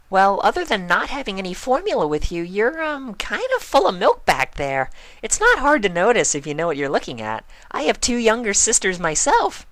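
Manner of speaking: high, quick, serious pony voice; earnest Pinkie. Acted. Pinkie confiding in Spike, high and earnest. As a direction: confide a big secret, high and fast.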